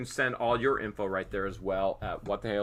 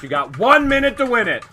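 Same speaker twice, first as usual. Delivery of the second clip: hyped countdown call; 12 semitones higher, 11 dB louder than usual. Real, not acted. Auction countdown announcement.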